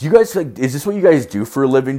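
a hammed-up outraged pool player, loud and high; mocking indignant bro.

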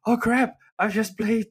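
retold oh crap, slowed and loud; mock shock. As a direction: act startled at the late hour. Noticing hours have passed.

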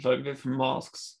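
usual speaking voice

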